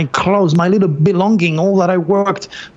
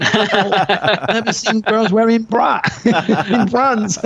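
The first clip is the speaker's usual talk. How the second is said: laughing slowly through the words; 5 semitones higher, much slower than usual. Real, not acted. Amused by the girls on the beach, slow laughing words. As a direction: laugh out the memory. Laughing at culture shock memory.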